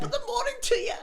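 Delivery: very high Irish leprechaun voice, laughing. Shown as a demonstration. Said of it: "Go for a leprechaun".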